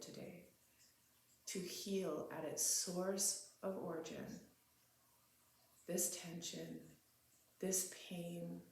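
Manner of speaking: quiet, spacious meditation leading, phrase by phrase; guided meditation. Real, not acted. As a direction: settle into a hushed, unhurried meditative prayer.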